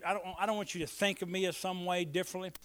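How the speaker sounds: usual speaking voice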